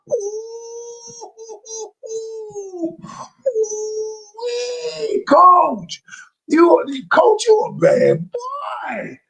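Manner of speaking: high squealing ooh-ing, cracking with laughter; excited squeals. Real, not acted. Coach cheering himself on, high excited squeals. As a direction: squeal with delight at your own point. Coach hyping up his own point.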